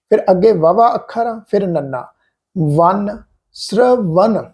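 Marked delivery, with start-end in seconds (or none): 2.8-4.5 ਸ੍ਰਵਨ drawn out slowly, sr joined, ending ਵਨ; slow careful reading. Shown as a demonstration. Teacher sounding out ਸ੍ਰਵਨ slowly for learners. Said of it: "ਪਾਠ ਦਾ ਉਚਾਰਨ ਕੀ ਹੈ?".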